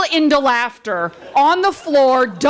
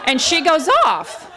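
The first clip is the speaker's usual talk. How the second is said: normal talking voice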